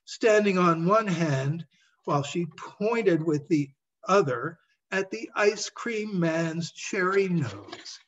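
plain reading voice

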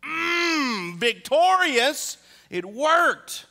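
high, loud, slow mock triumph; gloating inner voice. Husband's inner voice gloating over his scheme, high and loud. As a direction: savour the win like a cartoon villain. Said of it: "in my mind, I'm going".

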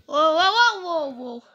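loud, high, startled whoops; alarmed cry. Real, not acted. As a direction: burst out in sudden alarm. Sudden alarm in game. (चौंककर ज़ोर से चिल्लाना)

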